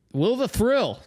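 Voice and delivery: sudden loud high burst of recall; excited. Real, not acted. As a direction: blurt it out like remembering.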